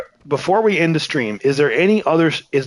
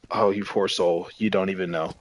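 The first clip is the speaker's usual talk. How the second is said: pitying; 6 semitones lower, 6 dB quieter than usual. Retold or imitated. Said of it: "I look back at myself and I'm like".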